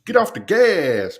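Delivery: loud, slow, high shout; catchphrase shout. Shown as a demonstration. Streamer trying out his new catchphrase, loud. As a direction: belt the new catchphrase like a battle cry. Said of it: "That's my new catchphrase".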